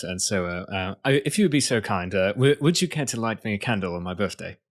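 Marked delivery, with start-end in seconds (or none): 1.0-4.6 polite put-on British accent. Shown as a demonstration. Guest performs the host's birthday line on request, British accent. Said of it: "get myself into a British accent".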